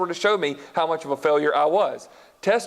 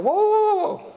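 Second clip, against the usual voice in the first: shocked listener; 9 semitones higher than usual. Pretend. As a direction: play a stunned listener catching the preacher out. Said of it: "some of y'all are thinking".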